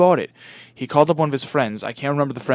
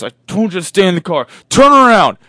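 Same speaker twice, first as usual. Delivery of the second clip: loud, high command building to a yell; shouted order; 5 semitones higher, 10 dB louder than usual. Acted. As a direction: bellow at the friend to obey. Angry driver ordering frightened friend.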